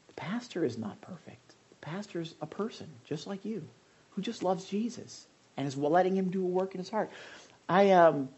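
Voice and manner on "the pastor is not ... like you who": whispered confession. Real, not acted. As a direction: breathe it as a secret. Confiding aside to congregation.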